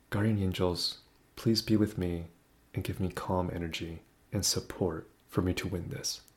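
very soft, low-voiced calm plea; calm whispered prayer. Praying to guardian angels before playing, hushed and calm. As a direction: murmur a small prayer under your breath. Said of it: "I whispered that. I said it very calmly".

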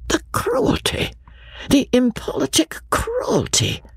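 hushed, high, pained dismay; great feeling. A narrator's reading. The Colonel hears Eleanor confirm the injustice, hushed and deeply pained. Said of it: "with great feeling".

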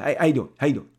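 hearty phone hello, pushed loud; Bob Harris voice. Retold or imitated. Editor greeting the artist, loud hearty voice. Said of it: "That's how he talked".

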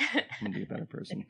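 soft, breathless vow to change; whispered resolve. Retold or imitated. A stunned radio listener vowing to change, whispering. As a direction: murmur it like a moved convert.

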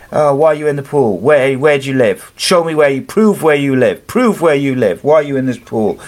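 mock-grill him like a nosy neighbour; imitating a hostile woman. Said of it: "she's just being horrible and, uh, just aggressive and abusive".

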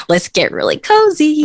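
high, playful sing-song invite; mock-cozy high voice; playful aside. Real, not acted. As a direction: pitch it way up, jokey and cutesy. Playful aside before explaining Snapchat.

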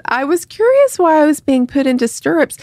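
high quoted-patient voice; puzzled patient quote. Acted.

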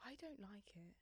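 very soft, voiceless whisper; hushed admission under the breath; whispered agreement. Real, not acted. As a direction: whisper it back like a shared secret. Whispers back the same confession.

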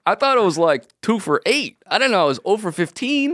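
high laughing fake-clueless voice; feigned innocence. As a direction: play dumb, laughing through it. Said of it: "And he goes".

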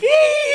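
squeal. Retold or imitated. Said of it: "And they all, like".

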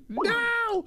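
very high squealed No; shocked no. Real, not acted. Host squeals in disbelief at caller's story. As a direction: yelp of real disbelief.

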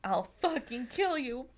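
a touch of angry threat; lightly acted rage at the driver. Gerard attacks the driver.

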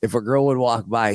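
normal talking voice

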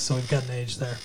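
usual speaking voice

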